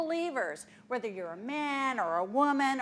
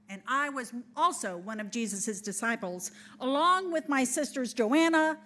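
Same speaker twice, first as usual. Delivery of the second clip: normal talking voice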